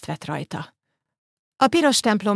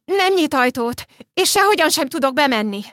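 high, loud panicked pleading, slowing down; frightened girl begging for help; panicked crying out; 8 semitones higher, 3 dB louder than usual. Acted. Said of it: "A lány szinte sikoltott". (pánikban, magas, hangos segélykérés)

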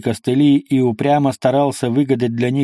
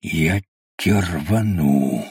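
low, slow, furious hiss; whisper yelling; 6 semitones lower, much slower than usual. Acted. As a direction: hiss it through the teeth, much lower. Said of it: "яростно прошипел разведчик".

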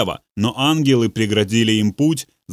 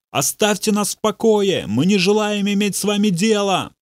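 frantic yell, much higher; 8 semitones higher than usual. A narrator's reading. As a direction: bellow the refusal in panic. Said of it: "заорал Бобби".

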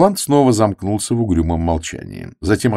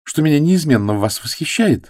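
higher, mock-admiring young woman; sarcastic; 9 semitones higher than usual. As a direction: needle him with fake praise. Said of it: "сказала Флора язвительно".

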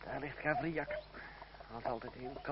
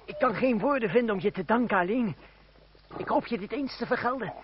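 higher and louder, heartfelt gratitude; fervent thanks; 7 semitones higher, 11 dB louder than usual. Acted. Thanking his cousin before riding off, fervent and loud. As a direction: pour out thanks in a rush.

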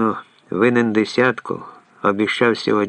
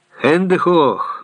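higher, drawn-out mock German command; full-throated yell; 7 semitones higher, 4 dB louder, slower than usual. A narrator's reading. Hans springs a scare on Leon, yelling. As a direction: holler it slowly to scare someone. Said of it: "Ганс зарепетував на всю горлянку".

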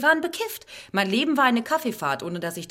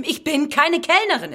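raised insisting; 7 semitones higher, 7 dB louder than usual. Acted. Repeated insistence, building up.

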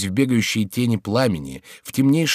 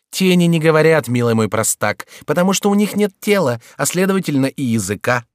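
higher, louder teasing voice; mocking jester Chicot; 7 semitones higher, 5 dB louder than usual. A narrator's reading. Chicot's ghost mocks the frightened king, higher and louder. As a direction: taunt the king like a sly jester.

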